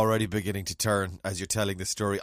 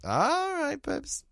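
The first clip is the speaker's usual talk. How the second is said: camp high voice; 14 semitones higher, much slower than usual. Acted. Mock affectionate babes.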